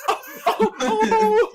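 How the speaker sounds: very high, loud hooting "oh, oh, oh"; exaggerated falsetto-high mock reaction; mock high-pitched "oh". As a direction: hoot it high in mockery. Mocking a reaction to jokes.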